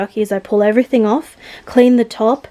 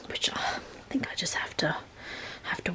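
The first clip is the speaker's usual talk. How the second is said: whispering; 4 semitones lower, 18 dB quieter, faster than usual. Real, not acted. Unvoiced aside.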